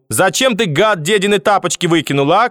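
high-pitched accusing cry; grandmother calling out angrily. A narrator's reading. Grandmother shouting from the corridor at grandson, angry cry. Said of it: "крикнула бабушка из коридора".

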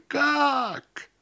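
very high, drawn-out cry; wail. A narrator's reading. Host hears bad news by telephone, wailing. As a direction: wail it in disbelief, pitch shooting up. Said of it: "донёсся вопль".

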